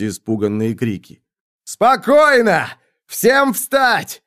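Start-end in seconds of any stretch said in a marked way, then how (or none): 1.6-4.2 very high, loud commanding yell; bellowed orders. A narrator's reading. Centurion orders frightened soldiers to get up, high yelling. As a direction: yell at panicked men to obey. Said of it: "орал какой-то сотник".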